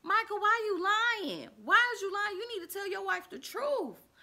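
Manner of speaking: high loud scolding; confronting Michael, high and loud. Retold or imitated. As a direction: call him out loudly. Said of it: "I was like".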